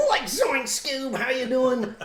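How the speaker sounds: high, slow Shaggy voice. Pretend. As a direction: drawl it like Shaggy. Doing Shaggy, Scooby-Doo riff.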